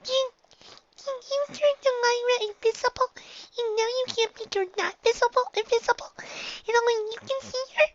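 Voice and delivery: high little filly voice; small child pony, very high; high squeaky young filly. A narrator's reading. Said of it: "Dinky asked".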